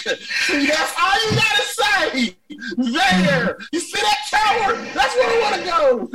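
high, loud, pleading cry toward the tower; excited shout. Acted. Tourist pointing out the tower, loud excited cry. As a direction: yell it like a desperate tourist.

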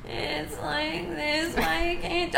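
high, slow whine; whiny mom voice. Retold or imitated. Imitating his mom's voice, a high slow whine. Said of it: "every time I make fun of my mom's voice, it's a whine".

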